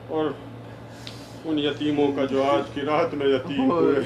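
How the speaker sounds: low, soft congregational dua; supplicatory prayer. Real, not acted. Leads closing supplication. (دھیمی، نیچی آواز میں عاجزانہ دعا)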